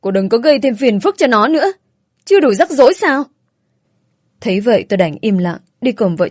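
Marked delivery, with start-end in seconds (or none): 0.0-3.2 put-on raised, scolding older woman; exasperated telling-off; angry outburst. A narrator's reading. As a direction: scold sharply, pitch climbing and getting louder. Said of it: "bà Hoài đã nổi đoá nói".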